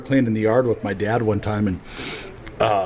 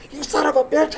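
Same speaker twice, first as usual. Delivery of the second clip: much higher mock-outraged curse; mock rage. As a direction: shriek the curse up high. Retold rage at his brother.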